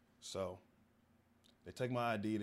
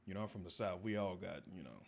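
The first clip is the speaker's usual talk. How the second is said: ordinary delivery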